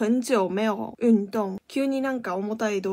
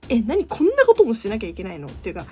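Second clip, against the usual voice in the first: exasperated complaint; 6 semitones higher, 5 dB louder, faster than usual. Retold or imitated. Venting about endless chores.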